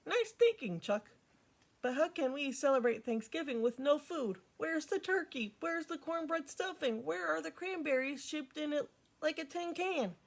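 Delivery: a lightly voiced excited tomboy girl; Peppermint Patty voice. A narrator's reading. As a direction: push the pitch up for Patty's questions.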